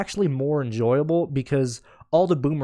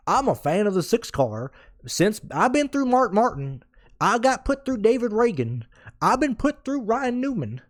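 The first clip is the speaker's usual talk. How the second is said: mocking a caller; 9 semitones higher than usual. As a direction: whine like a fed-up superfan calling in. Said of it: "reenacting how he was talking".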